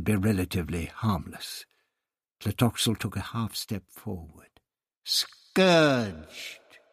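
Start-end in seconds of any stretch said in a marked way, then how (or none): 5.0-6.8 drawn-out, hissed single word; hissed menace. A narrator's reading. High priest proposes a punishment, hissing. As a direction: hiss it as a threat, higher than usual. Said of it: "he hissed".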